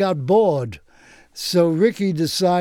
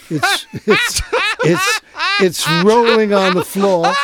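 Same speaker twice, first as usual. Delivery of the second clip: laughing through the words; giggling as he tells it; 8 semitones higher, 4 dB louder than usual. Real, not acted. Laughing while telling punchline.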